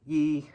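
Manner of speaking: yi said high and level, held steady; first tone yi. Shown as a demonstration. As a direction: keep yi high and flat. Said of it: "which is first tone".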